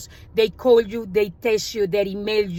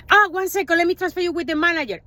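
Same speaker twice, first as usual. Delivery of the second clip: lightly voiced chirpy rep, higher; mock eager agent; 7 semitones higher, faster than usual. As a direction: brush her off brightly, then rush. Agency rep mocked, rushing transfer.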